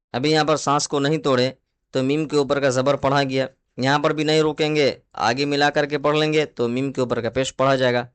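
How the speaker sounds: ordinary talk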